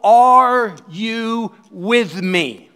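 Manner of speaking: earnest call. Real, not acted. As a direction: draw out each word to the congregation. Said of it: "I wanted to know".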